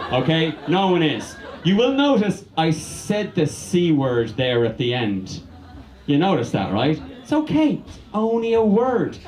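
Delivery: ordinary delivery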